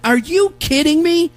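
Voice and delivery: high-pitched, disbelieving outburst; re-enacted indignant astonishment; incredulous. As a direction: let the question leap up in disbelief. Incredulous reply to unhelpful operator.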